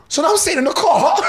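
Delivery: high voice breaking into laughter; helpless high-pitched amusement; laughing in disbelief. Real, not acted. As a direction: crack up as you tell it. Cracking up at his predicament.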